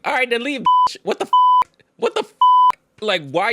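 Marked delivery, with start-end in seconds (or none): 0.0-1.3 high, loud snap to leave; outraged. Real, not acted. Creator yells at the boyfriend, high and outraged. Reacting to the quoted fight. 2.0-2.3 high stunned cry; shocked. Real, not acted. Creator reacts in high shock to the boyfriend.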